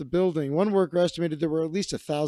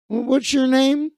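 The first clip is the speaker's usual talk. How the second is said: high, louder demand; suspicious question; 9 semitones higher, 5 dB louder than usual. Retold or imitated. Dave demands the caller's name, high and loud.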